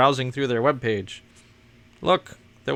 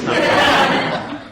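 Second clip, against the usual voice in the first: squeaky laughing voice; 9 dB louder, much slower than usual. Pretend.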